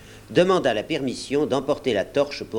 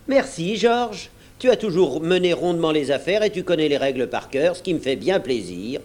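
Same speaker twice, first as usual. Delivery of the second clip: mock thanks; 6 semitones higher than usual. A narrator's reading. Silver mocks George.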